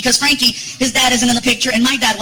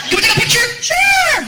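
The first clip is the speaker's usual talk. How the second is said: high, eager fan voice; excited fan; 12 semitones higher than usual. Retold or imitated. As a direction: play a fan asking for a picture. Fans asking for a photo.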